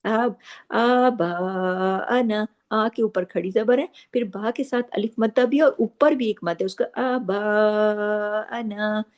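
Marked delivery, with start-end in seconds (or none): 0.7-2.5 आबाना with both alifs stretched very slowly; long madd. Shown as a demonstration. Teacher stretching आबाना slowly to give the madd its full due. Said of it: "पूरा हक अदा करना है". 7.0-9.0 आबाना with both alifs stretched very slowly; long madd. Shown as a demonstration. Teacher stretching आबाना slowly to give the madd its full due. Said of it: "पूरा हक अदा करना है".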